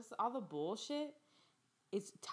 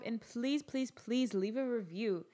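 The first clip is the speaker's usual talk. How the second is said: ordinary talk, nothing marked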